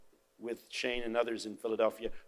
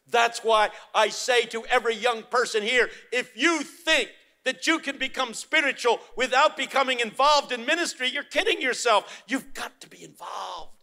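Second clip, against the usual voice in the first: high, loud, building preacher's cry; impassioned sermon climax, much higher and louder; preaching at full force; 11 semitones higher, 8 dB louder than usual. Acted. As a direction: wail it out from the pulpit, slowing down. Exhorting young people.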